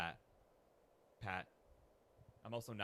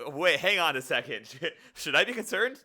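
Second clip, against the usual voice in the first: mock alarm; 6 semitones higher, 10 dB louder than usual. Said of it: "I was like".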